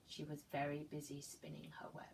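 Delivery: hushed storybook whisper; soft whispered refrain. Acted. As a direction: whisper it as a secret to the children. Refrain whispered while reading aloud.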